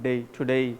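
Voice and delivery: American day, today, every day, Wednesday with the ay tail cut short; teacher demoing American day; American accent. Shown as a demonstration. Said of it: "Americans ... थोड़ा सा कम कर देते हैं".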